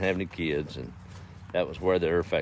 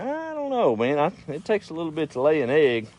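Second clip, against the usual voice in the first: chicken character; 9 semitones higher than usual. Acted. Chicken disputing who does most on farm, high-pitched doubt. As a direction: squeal the opening, then ease into higher talk. Chicken speaks in farmyard joke.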